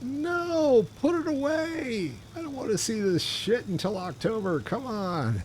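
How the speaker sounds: high hammed-up protest over early pumpkin spice; mocking annoyed friends. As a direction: squeal it like a fed-up friend. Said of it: "social media are all, like, going".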